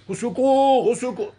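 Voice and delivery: loud, high cry of au secours; cry for help. Retold or imitated. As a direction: call out au secours like someone drowning. Man calling for help in pool. (appel au secours crié fort)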